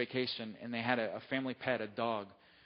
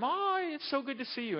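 put-on much higher gushing child's greeting; excited kids; 13 semitones higher than usual. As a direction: gush over the dog like a thrilled kid.

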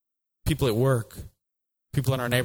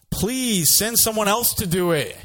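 pleading Moses; 8 semitones higher than usual. Acted.

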